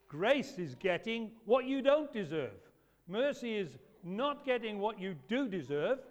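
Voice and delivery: ordinary delivery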